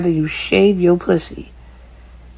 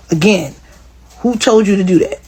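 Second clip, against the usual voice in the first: normal talking voice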